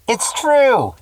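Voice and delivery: loud, high cry; shout. A narrator's reading. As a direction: shout it from the back. Voice from the back.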